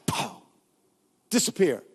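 usual speaking voice

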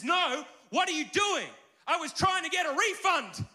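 high, loud, slow indignant cry; outraged man protesting the payment; indignant outburst. Retold or imitated. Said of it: "But the man's indignant".

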